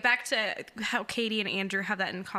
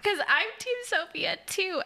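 higher-pitched, gushing excitement; excited; 7 semitones higher than usual. Real, not acted. Host confessing her team to her co-host, excited. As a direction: gush with excitement, voice lifted high. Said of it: "I was so excited".

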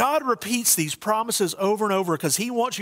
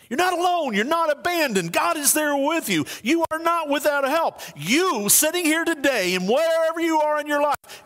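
higher, fervent preaching run; full-force preaching; 8 semitones higher than usual. Acted. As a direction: lift the whole room with it. Preaching climax to congregation.

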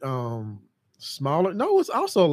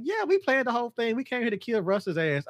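higher, cheeky boasting voice; gleeful reveal; 8 semitones higher than usual. Retold or imitated. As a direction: brag about the plan. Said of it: "they're like".

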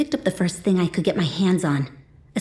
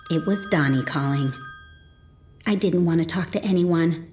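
usual speaking voice

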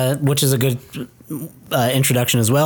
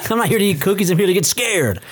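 eager, excited; 6 semitones higher, faster than usual. Retold or imitated. Acting Dave's eager line.

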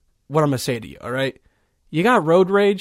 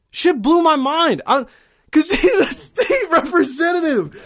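amazed; 11 semitones higher than usual. Real, not acted. Still amazed at the video.

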